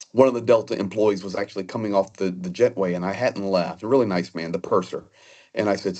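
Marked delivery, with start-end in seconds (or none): none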